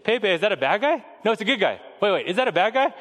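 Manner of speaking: little daughter. Retold or imitated.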